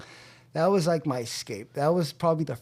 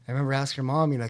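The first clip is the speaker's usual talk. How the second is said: ordinary delivery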